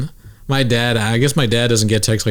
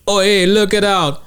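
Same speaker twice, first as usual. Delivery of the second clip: high, drawn-out mock Jamaican; fake Jamaican accent; 6 semitones higher, much slower than usual. Imitating the comic undoing the microphone, slow fake Jamaican accent. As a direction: stretch it out in a put-on Jamaican accent. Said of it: "pretended to be Jamaican".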